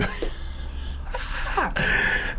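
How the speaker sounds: soft laugh that jumps up high; real airy, high-pitched chuckle; amused laugh. Real, not acted. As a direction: crack yourself up quietly. Laughs at his imagined scenario.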